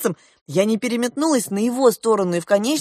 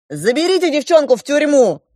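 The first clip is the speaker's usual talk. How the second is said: raised, loud command to guards; barked order; 6 semitones higher, 5 dB louder than usual. A narrator's reading. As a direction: order them to seize her, loudly. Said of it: "гаркнул старший принц". (громкий властный окрик)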